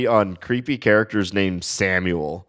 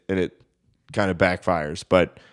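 ordinary talk, nothing marked

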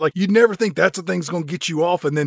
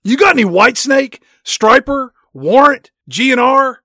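loud, high eager asking; mock hype; 8 semitones higher, 9 dB louder than usual. Asking Tim Robbins for hair metal, loud hammed-up hype. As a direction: rattle off bands like an excited metalhead.

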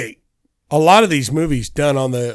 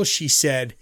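normal talking voice

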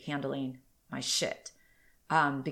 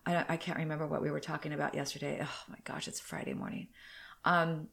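usual speaking voice

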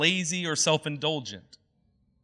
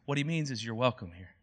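softer, kind reassurance; calm him with a soft welcome; gentle welcome; 4 semitones lower, 7 dB quieter than usual. Retold or imitated. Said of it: "the hostess looks at him and says".

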